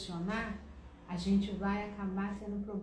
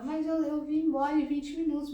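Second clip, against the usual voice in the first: raised, loud self-defence; defensive husband; 6 semitones higher, 7 dB louder than usual. Retold or imitated. Husband defending himself to his wife, louder and higher. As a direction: protest your innocence loudly.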